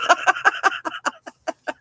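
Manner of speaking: high laughter trailing off; real laugh. Real, not acted. Smoker amused by being "safe", high real laughter. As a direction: keep laughing after your punchline.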